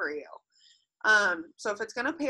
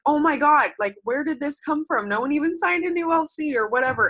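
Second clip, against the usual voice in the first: surprised; 7 semitones higher than usual. Retold or imitated. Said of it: "And you're like".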